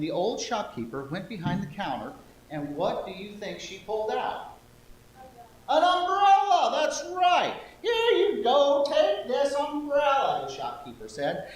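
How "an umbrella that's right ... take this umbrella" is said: high, loud, slow grandma voice; old lady voice. Acted. Shopkeeper giving the girl an umbrella in old lady voice. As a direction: hand it over like a delighted old lady. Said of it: "my old lady voice".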